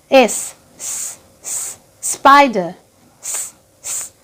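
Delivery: স as a long unvoiced hiss; hissed S sound. Shown as a demonstration. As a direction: hiss স slowly like a snake. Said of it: "উচ্চারণ কিরম হবে". (টানা স স হিস ধ্বনি)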